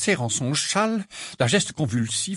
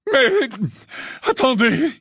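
much higher, louder sudden cry; 12 semitones higher, 5 dB louder, slower than usual. A narrator's reading. Trelawney suddenly stopping the inspector. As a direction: blurt it out all at once. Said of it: "dit soudain le professeur Trelawney".

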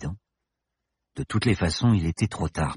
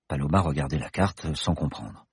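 plain reading voice

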